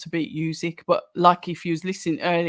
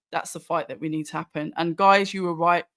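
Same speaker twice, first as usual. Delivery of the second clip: ordinary talk, nothing marked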